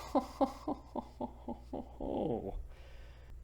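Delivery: airless sneering laugh; ferryman's breathy jeering laugh; mocking breathy laugh. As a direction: laugh at him without voice.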